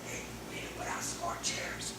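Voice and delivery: hushed backstage whisper. Acted. Church worker to a helper in the back, whispering. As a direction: whisper it so the service isn't disturbed. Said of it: "so you gotta whisper like".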